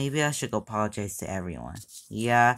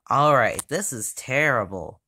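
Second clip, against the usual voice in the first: usual speaking voice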